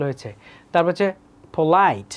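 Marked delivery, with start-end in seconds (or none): 1.5-2.1 polite with the beat on the second syllable; second-syllable stress in polite. Shown as a demonstration. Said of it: "polite second syllable আসছে". (পোলাইট-এর দ্বিতীয় সিলেবলে জোর)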